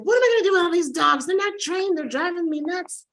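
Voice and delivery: high, fast panicked owner voice; Mary Lynn's lament over the dogs, in a high frantic voice; frazzled Mary Lynn. Acted. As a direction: fret like she's overrun with dogs. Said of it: "She's like".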